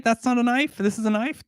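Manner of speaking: high, playful mock Australian accent; Crocodile Dundee line. Australian accent, reading a viewer's joke back to chat. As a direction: toss off the Aussie knife line. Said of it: "you knew I was gonna say it".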